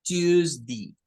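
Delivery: "Tuesday" ending in short i, tuzdi; variant ending. Shown as a demonstration. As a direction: end "Tuesday" on di. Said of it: "pueden decirlo como Tuesday".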